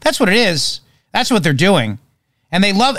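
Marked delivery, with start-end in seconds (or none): none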